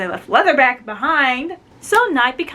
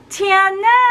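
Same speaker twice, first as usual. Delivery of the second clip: high, loud whiny cry of a name; mock outcry; 9 semitones higher, 5 dB louder than usual. Ivy protesting the duel, mock outcry. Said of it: "she's like".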